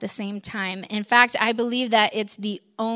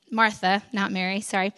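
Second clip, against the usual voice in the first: normal talking voice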